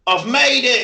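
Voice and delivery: loud, high imitation of a drunk lad; hammed-up boozy cheer; drunken triumph. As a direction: announce a grand arrival as a mock drunk. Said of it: "he was pissed".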